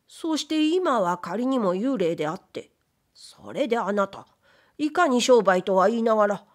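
usual speaking voice

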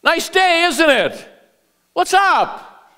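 high-pitched everyday small talk; casual greeting. Acted. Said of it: "The common informal way somebody".

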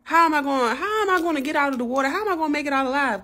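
high, loud, frantic questions; retold panic, voice jumping up high; panicking. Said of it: "I'll be panicking in that water".

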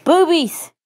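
high, loud blurt; loud exclamation. Acted. Lady shouts at Mustache Man through the window, loud and high. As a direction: yell it out suddenly. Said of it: "She said".

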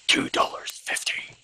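hushed, voiceless reveal of the price; pretend-horrified whisper; mock-dramatic whisper. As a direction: whisper it as if the sum were shocking. Mock-terrifying price, dropped to whisper.

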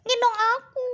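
high, loud squeaky cat voice; kitten character. Pretend. Cat forbids Cici to carry baby, high bossy cat voice. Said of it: "Dedeknya kucing gitu maksudnya".

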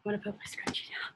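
quick breathy whispered aside; whispering. Real, not acted. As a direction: whisper it fast, off to the side. Aside while leading workout.

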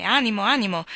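loud, lifted cheering-on; rally him boisterously; hearty exclamation. A narrator's reading. Visitor slapping a downcast friend on the shoulder, hearty exclamation. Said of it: "esclamò rivolto a Loboraf".

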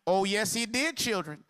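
normal talking voice

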